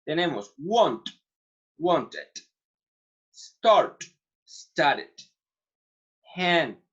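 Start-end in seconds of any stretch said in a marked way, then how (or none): 1.8-2.3 wanted with a full extra syllable, want-ed; wanted, the ed sounded out clearly. Shown as a demonstration. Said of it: "Vamos a ver el ejemplo con et". 4.4-5.1 started with a full extra syllable, start-ed; started, the ed sounded out clearly. Shown as a demonstration. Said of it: "Vamos a ver el ejemplo con et".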